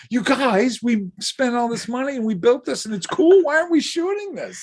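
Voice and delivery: high-pitched, exaggerated protest; mock pleading complaint. Makeup effects person imploring the crew to shoot it, mock pleading. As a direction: plead with them in a put-on high whine. Acting out own on-set complaint.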